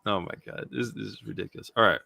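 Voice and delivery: plain reading voice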